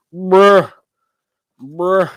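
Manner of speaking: loud high exasperated bruh. Real, not acted. Streamer dismayed at his spit, loud bruh. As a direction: groan it out at the mess.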